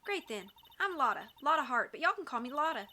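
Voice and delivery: friendly put-on Southern accent; Lotta Hart. Photographer introducing herself to investigators, Southern accent. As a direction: introduce yourself with a Southern twang. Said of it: "maybe I should give her a Southern voice".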